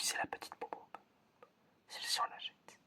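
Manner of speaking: ordinary delivery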